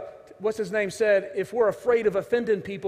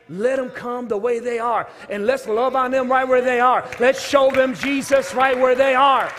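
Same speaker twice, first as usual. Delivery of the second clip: louder, building, slowing to the last line; full-force preaching; 3 semitones higher, 6 dB louder than usual. Acted. Pastor urging the church to welcome sinners, building to full force. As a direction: preach it home, rising to a roar.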